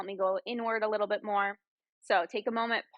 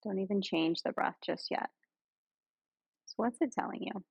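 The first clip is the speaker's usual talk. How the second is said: guided meditation; 3 semitones lower than usual. Real, not acted. Yoga guide leading friends through breath and body scan, guided meditation. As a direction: ease them into stillness, lower and unhurried.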